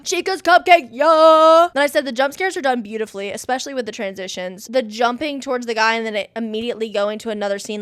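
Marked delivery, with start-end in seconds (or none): 0.0-1.7 excited. Retold or imitated. Reading excited notes aloud.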